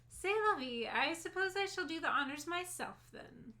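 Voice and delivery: lightly voiced haughty lady; a hint of a bored socialite; fancy lady voice. Reads fancy lady character.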